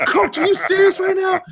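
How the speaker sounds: hammed-up teenage disbelief; mock incredulous teen. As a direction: squeak it like a teen who can't believe it. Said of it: "And Keontae's like".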